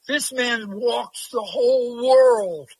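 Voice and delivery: slow and loud, stretching out the whole world; imitating a little girl bragging to her family; excited little girl. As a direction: announce it proudly like a thrilled kid. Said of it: "she runs up to him and said".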